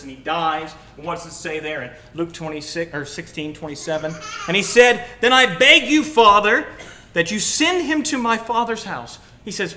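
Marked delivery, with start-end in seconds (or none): 5.2-9.2 higher, loud desperate plea; begging rich man. Acted. Rich man in torment pleading with Abraham, loud. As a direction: cry out to Father Abraham.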